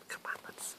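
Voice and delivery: ordinary talk, nothing marked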